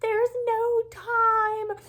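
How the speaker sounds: very high, loud, slow tearful wail; retold wail. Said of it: "when I was bawling my eyes out".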